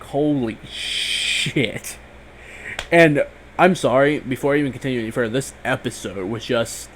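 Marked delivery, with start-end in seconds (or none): 0.0-2.0 slow, hushed, stunned holy shit; stunned disbelief. Retold or imitated. Fan reliving Carl losing his eye, slow and stunned. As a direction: let it sink in, slowly and quietly. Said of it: "It's like".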